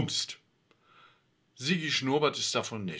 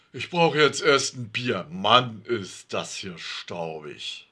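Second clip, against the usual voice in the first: exhausted; 3 semitones lower than usual. A narrator's reading. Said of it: "Alfred nickt schwach".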